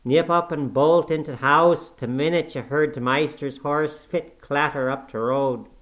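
loud, slow Yorkshire scolding; spiteful tirade. A narrator's reading. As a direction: spit the insults at her like an old servant. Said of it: "directing his discourse to me".

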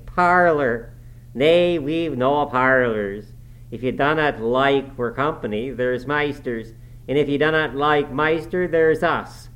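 put-on loud, scornful Yorkshire old servant; sneering. A narrator's reading. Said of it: "he echoed sneeringly".

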